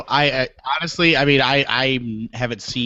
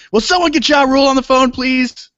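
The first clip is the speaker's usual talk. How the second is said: high, loud mock desperation; hammed-up exasperated shout; exasperated plea; 13 semitones higher, 6 dB louder than usual. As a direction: call out urgently, pitched way up, as a comedy punchline. Retelling a stand-up bit's exasperated plea.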